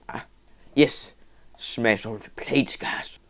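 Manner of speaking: ordinary talk, nothing marked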